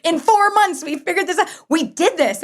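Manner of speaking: high, excited and a little breathless; retold thrill at getting married; giddy disbelief. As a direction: insist with amazed glee, laughing.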